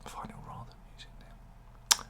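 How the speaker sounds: breath only, no voice, a private joke; whispered to the viewers as he repeats himself. Pretend. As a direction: whisper it, repeating the line as a sly aside.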